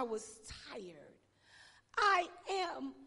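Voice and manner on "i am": loud, high stress; preached emphasis. Acted. Pastor stressing the words to the congregation. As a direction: hit it with force.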